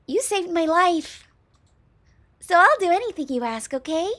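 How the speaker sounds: high, cutesy and eager; cute girl voice. Acted. Slime girl thanking her rescuer, high and cute. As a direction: pitch it up, sweetly devoted. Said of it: "Wobbly, high-pitched, and very cute".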